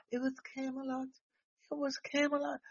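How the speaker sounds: hushed sarcastic mimicry of a romantic myth; mock dreamy. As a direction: sigh it out like a swooning fairy tale. Mocking Jill Biden's fairy tale.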